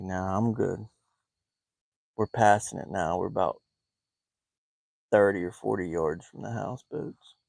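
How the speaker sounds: low, hushed aside; trying to be quiet. Real, not acted. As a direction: keep it down so the neighbor won't hear. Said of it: "trying to kinda be quiet".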